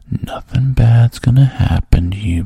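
coaxing man. Acted. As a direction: lean in and reassure her out loud. Man's promise.

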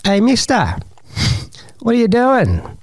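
put-on high, cheeky kid's voice; boy calling out to a stranger, high and cheeky. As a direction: pipe up like a nosy teenager. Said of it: "He goes".

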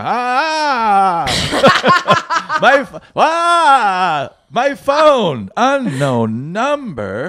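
high, slow flustered cries; a flustered old person startled by the ringing phone; panicked senior. Retold or imitated. Senior panicking at an unknown caller, high and slow.

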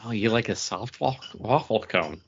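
ordinary talk

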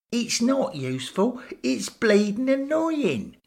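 angry outburst. Acted. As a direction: blow up at him, fed up. Lamper snaps back at Bill.

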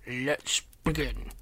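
slow toad croak. A narrator's reading. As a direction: croak it out slowly. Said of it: "all three croaked".

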